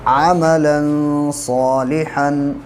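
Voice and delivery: slow tajweed recitation of अमलन सालिहन; Quranic recitation. Shown as a demonstration. Teacher demonstrating tajweed to students, slow recitation. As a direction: recite अमलन सालिहन slowly, nasal hidden noon. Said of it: "तनवीन के बाद स्वाद है".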